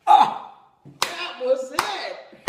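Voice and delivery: loud, high outburst, drawn out slowly; explosive revelation cry; big release. Retold or imitated. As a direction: burst out as if it all just clicked. Relief at wife's gentle words.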